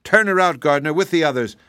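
high, loud order; commanding. A narrator's reading. Roses order the gardener to expel her, loud command. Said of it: "commanded the roses".

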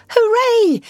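high, loud cheer; jubilant cry. A narrator's reading. Rat celebrating his find in the snow, jubilant cry. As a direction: cry out in delight. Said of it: "the rat cried".

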